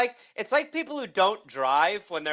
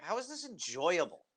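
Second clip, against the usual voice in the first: soft, under-the-breath disbelief; hushed mock bewilderment; baffled onlooker; 8 dB quieter than usual. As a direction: say it quietly, as a puzzled aside. Imitating baffled onlookers watching swimmers.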